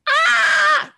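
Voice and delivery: sudden high cry of frustration, loud; frustrated wail. Retold or imitated. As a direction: let out an exasperated wail. Frustrated outburst at husband.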